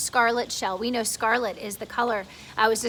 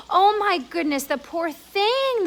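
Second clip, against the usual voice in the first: ladybug crying out; 7 semitones higher, 6 dB louder, slower than usual. Acted. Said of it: "the ladybug cried".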